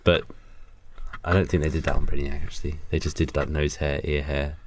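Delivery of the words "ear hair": ordinary talk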